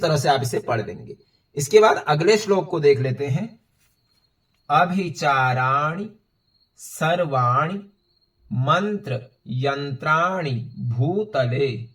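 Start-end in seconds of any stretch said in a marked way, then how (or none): 4.7-11.9 drawn-out, measured word-by-word Sanskrit reading; slow teaching recitation. Shown as a demonstration. Teacher shows learners how to read the forty-seventh shlok, slow recitation. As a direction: recite slowly, syllable by syllable. Teacher begins breaking down next verse.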